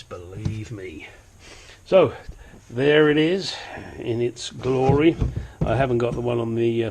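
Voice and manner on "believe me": hushed, slow confiding whisper; slow conspiratorial whisper; whispered aside. Real, not acted. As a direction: lean in and whisper it to the viewer.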